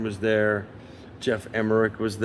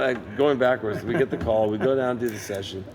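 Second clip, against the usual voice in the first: ordinary talk, nothing marked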